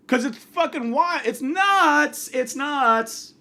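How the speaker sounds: ordinary delivery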